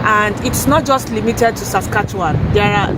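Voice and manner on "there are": ordinary talk, nothing marked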